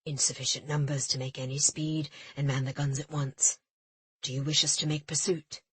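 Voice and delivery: steady officer awaiting orders; a hint of a composed first mate; lightly voiced Jin. A narrator's reading. Jin's voice held.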